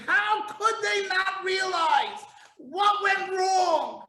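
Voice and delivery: high, slow, exasperated plea; preacher pleading in disbelief; exasperated outcry. Real, not acted. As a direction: cry it out in frustration.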